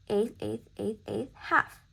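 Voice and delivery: even "eighth" beats, firmer HALF; spoken rhythm pattern. Shown as a demonstration. Teacher counting rhythm occurrences for students, spoken rhythm pattern. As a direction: count the notes off evenly, landing on "half". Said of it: "Okay, let's count".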